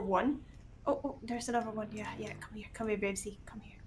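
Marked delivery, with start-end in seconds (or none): 1.9-3.8 murmured, repeated animal call; soft coaxing. Real, not acted. Player calling Babesy over, soft coaxing. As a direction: coax it over under your breath.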